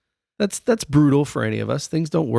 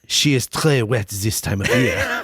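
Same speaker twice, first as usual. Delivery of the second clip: put-on French accent. French saucier warning the king off his trip, in a French accent. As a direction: plead like a cartoon Frenchman. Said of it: "A French guy in Scotland".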